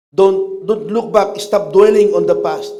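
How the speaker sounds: loud, urgent exhortation; preaching loud. Real, not acted. Pastor urging congregation to forget the past, loud preaching. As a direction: call it out to the whole church.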